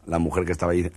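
ordinary talk

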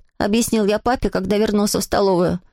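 plain reading voice

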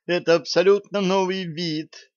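put-on high, thrilled girl's cry of discovery; excited. A narrator's reading. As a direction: gush with delight. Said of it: "восторгалась Алиса".

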